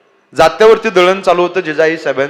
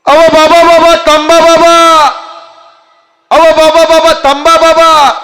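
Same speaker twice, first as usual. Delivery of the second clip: put-on high, loud, drawn-out child's call; little girl calling out; 12 semitones higher, 7 dB louder, slower than usual. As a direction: call after him like a small girl. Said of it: "मोठ्याने आवाज दिला".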